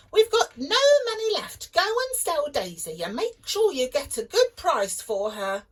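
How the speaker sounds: high, loud, fast scolding mum; mum's voice. A narrator's reading. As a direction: nag him like a fed-up mother. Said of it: "Jack's mum said".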